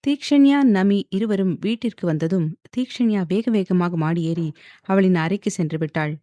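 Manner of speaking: ordinary delivery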